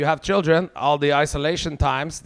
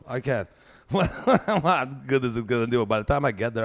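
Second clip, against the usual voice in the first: usual speaking voice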